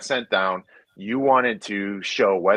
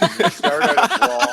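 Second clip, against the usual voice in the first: laughing; 6 dB louder than usual. Real, not acted. Laughing while telling the brawl.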